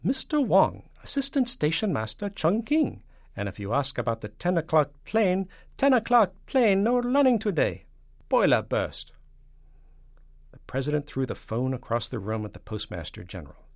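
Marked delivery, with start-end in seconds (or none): 0.0-9.1 high, loud caricatured Chinese stationmaster; Mister Wang. Acted. Stationmaster informing the president about transport, high caricature. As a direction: announce it in a high, emphatic caricature voice.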